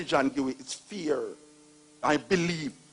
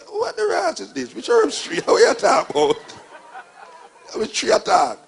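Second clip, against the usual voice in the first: incredulous; 9 semitones higher, 6 dB louder than usual. Retold or imitated. Mocking Rasta tree belief.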